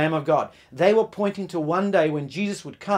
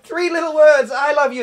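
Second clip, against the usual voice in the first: higher, louder desperate call; mock cry out; 10 semitones higher, 8 dB louder than usual. Husband banging on the back window, higher louder mock cry. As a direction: wail it through the window. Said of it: "he cries out".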